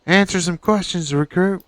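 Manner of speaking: barking gym coach. Pretend. Player barks orders at recruit.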